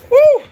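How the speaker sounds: piercing, high-pitched "woo"; sudden shrill exasperated whoop; loud high woo. Real, not acted. Venting over fake cotton robe.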